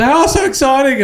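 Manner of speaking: high, squealy mock excitement; mock gushing. Mocking the client's gushing excitement about the new house. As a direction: imitate an over-the-top gushing client.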